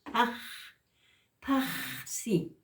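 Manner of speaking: PAJ broken off on a long rush of breath, then PAXI; aspirated J. Shown as a demonstration. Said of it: "el signo expirado".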